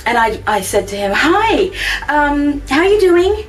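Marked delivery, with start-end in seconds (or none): 1.1-3.4 awkward greeting. Retold or imitated. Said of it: "It probably sounded really lame".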